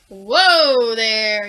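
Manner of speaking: loud startled call; yelled. Acted. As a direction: stop her with a loud shout. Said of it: "yelled a worm".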